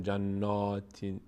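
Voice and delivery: جناتین with a deep rounded aw-like نا; full-mouthed long آ in جناتین; slow wrong take, dark نا in جناتین; wrongly heavy vowel. Shown as a demonstration.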